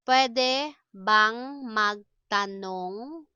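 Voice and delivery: very drawn-out, rising at the end; word by word, held syllables; slow teaching model. Shown as a demonstration. Teacher models asking permission for learners, slowly.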